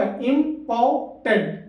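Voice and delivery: इंपॉर्टेंट stretched very slowly, three clear beats; syllable by syllable. Shown as a demonstration. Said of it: "तीन बार बोला".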